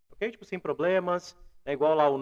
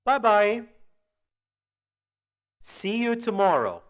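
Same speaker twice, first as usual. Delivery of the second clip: loud, higher English model phrases; raised, deliberate teacher demonstration; language-teacher model; 4 semitones higher, 6 dB louder, much slower than usual. Shown as a demonstration. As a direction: project it clearly for learners. Model phrases for students to repeat.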